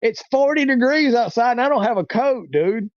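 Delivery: retold high-pitched plea of disbelief; incredulous protest. Stranded man inwardly answering the preacher, incredulous. His protest at being sent walking.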